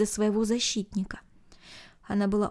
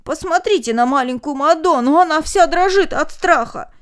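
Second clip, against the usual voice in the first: loud pitying call; 6 semitones higher, 8 dB louder than usual. A narrator's reading. Young man points out girl.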